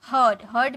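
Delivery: "हर्ड" as a single syllable, hurd; correct pronunciation. Shown as a demonstration. Teacher modelling for students, "हर्ड" pronounced hurd. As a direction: model "हर्ड" without any ee sound, like bird. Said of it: "ताकि आप गलत pronounce ना करो".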